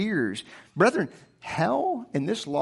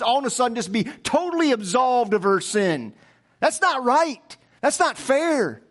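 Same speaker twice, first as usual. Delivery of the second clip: higher-pitched mock protest of unfairness; indignant objection; 4 semitones higher than usual. Preacher voices a lost mind objecting to grace, indignant. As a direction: argue like an outraged skeptic, voice raised in pitch. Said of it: "says a lost mind".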